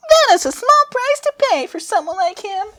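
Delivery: put-on very high, squeaky girl voice; Cronoa voice. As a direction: pitch it way up like a tiny goddess.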